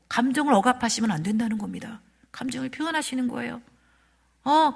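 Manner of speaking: ordinary talk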